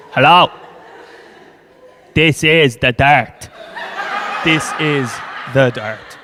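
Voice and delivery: loud, slow, halting announcement; Dublin train driver. Acted. Driver announcing to passengers first time, loud slow delivery. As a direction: spell it out haltingly into the intercom.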